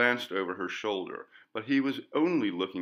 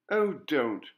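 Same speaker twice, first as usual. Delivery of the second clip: a sudden, higher and slightly louder blurt; exclaimed; 8 semitones higher, 4 dB louder than usual. A narrator's reading. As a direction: blurt it out before she can stop herself. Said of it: "Lady Jane exclaimed".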